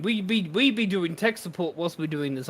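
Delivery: ordinary delivery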